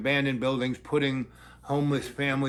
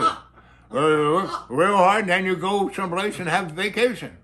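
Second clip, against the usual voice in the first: a goofy half-mocking Scooby-Doo imitation; 3 semitones higher, 5 dB louder than usual. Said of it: "he's talking like Scooby-Doo".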